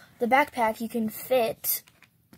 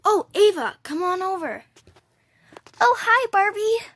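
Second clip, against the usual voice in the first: bright excited greeting; 8 semitones higher, 3 dB louder than usual. Pretend. Doll greeting a friend.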